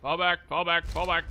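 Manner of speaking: urgent repeated retreat call; loud, hurried fall back orders; frantic retreat order. Real, not acted.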